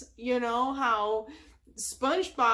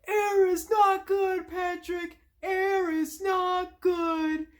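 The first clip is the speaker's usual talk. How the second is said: higher, louder, slow, strained; suffering plea; 7 semitones higher, 4 dB louder, slower than usual. Retold or imitated. SpongeBob on the floor begging Patrick, strained and slow. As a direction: gasp it out like you're drying up. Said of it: "So he's like".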